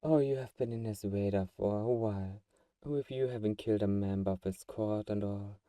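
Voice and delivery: ordinary delivery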